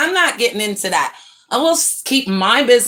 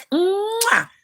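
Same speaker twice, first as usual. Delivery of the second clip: blown kiss; 12 semitones higher than usual. Real, not acted. Blowing a kiss to viewer.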